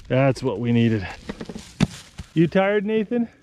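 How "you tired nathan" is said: gentle check-in. Real, not acted. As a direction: ask him tenderly, like a parent. Gently checking on tired Nathan.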